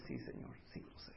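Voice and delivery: soft whispered reply; humble whisper. Acted. Moses humbly answering God, soft whisper. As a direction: breathe it out humbly.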